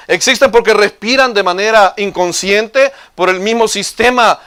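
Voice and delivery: ordinary delivery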